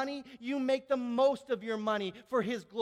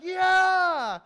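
loud, higher crowd cheer; crowd yell; 8 semitones higher, 12 dB louder than usual. Retold or imitated. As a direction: shout it back like a crowd. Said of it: "Everybody's like".

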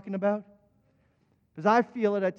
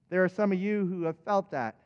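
ordinary delivery

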